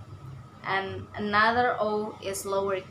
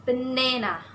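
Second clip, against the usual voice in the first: "Banana" with a strong, long NA in the middle; light ba, stressed NA, falling end; stress on middle syllable; 2 semitones higher than usual. Shown as a demonstration. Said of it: "like that".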